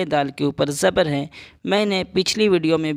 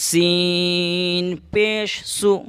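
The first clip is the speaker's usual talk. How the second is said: very slow, drawn-out syllables; teacher spells سو slowly; slow spelling; 2 semitones higher, much slower than usual. Shown as a demonstration. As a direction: spell سین پیش سو deliberately. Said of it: "سین کو جلدی پڑھ رہے ہیں".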